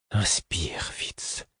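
urgent whisper; whispered order. A narrator's reading. The fool ordering Fitz to breathe, whispered. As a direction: whisper the command firmly. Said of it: "m'ordonna le fou".

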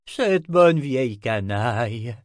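slow, loud exclamation; drawn-out, emphatic old sorcerer's exclamation; exclaiming sorcerer. A narrator's reading. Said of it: "s'exclama le sorcier".

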